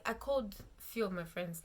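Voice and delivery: normal talking voice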